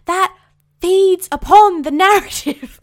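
slow, loud, high push on every word; emphatic conviction. Real, not acted. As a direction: lean hard into each word. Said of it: "That is ridiculous".

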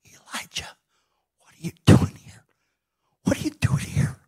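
whispered question, no voice at all; God whispering gently to Elijah; still small voice. Acted. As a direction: whisper it, with space between the phrases. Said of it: "in this still small voice".

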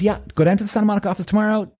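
high, hurried receptionist voice. Retold or imitated. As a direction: imitate a woman giving quick instructions. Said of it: "So she goes".

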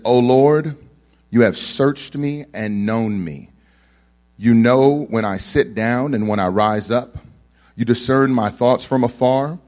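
loud, slow, solemn scripture reading. Acted. Preacher reads Psalm 139 aloud to the congregation, solemn and loud. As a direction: proclaim the psalm slowly to the congregation.

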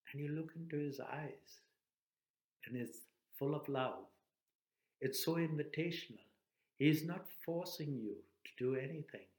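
ordinary delivery

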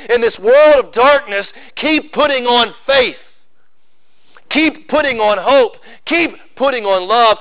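rally the flock with each keep; rousing preaching. Acted. Pastor exhorting congregation to keep faith, rousing preaching.

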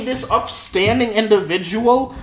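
high, hammed-up incredulity; mock disbelief. Listener hears the upstanding man lied, mock disbelief. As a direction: feign outrage, pitching up into the questions.

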